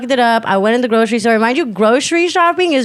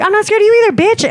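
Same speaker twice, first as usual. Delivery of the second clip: high, combative comeback; defiant retort; 11 semitones higher, faster than usual. Retold or imitated. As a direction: throw it back at her, pitched up. Acts out retort in store quarrel.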